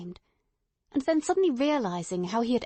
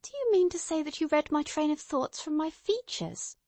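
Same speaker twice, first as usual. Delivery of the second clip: incredulous; 7 semitones higher than usual. Acted. Watson incredulous.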